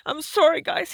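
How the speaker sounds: apologizing through tears; choked up. Real, not acted. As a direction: overcome and breaking off. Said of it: "very emotional".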